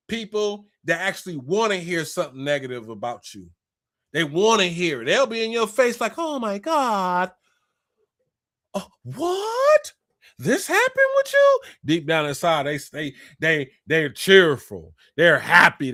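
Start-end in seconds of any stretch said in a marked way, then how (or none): 6.2-11.6 shrieking higher and higher, hammed-up gasp; mock fake surprise. Mocking a fake friend who loves bad news, hammed-up shock. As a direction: play the two-faced friend, gasping in fake shock.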